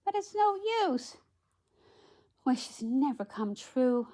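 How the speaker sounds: high storybook heroine giving up hope; resigned princess voice; Cinderella. A narrator's reading. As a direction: let the high voice sink, slowing at the end. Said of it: "said Cinderella".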